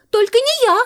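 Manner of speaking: high, loud cry of refusal; shouted refusal. A narrator's reading. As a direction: shout it quickly to dodge the job. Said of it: "закричал один". (выкрикивает отказ высоким голосом)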